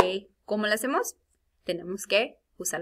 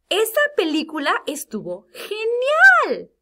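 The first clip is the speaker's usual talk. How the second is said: very high, loud excited exclamation; exclamación emocionada; 13 semitones higher, 9 dB louder than usual. Shown as a demonstration. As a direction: exclaim it with big drawn-out emotion. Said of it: "está enseñando emoción". (exclamación muy emocionada)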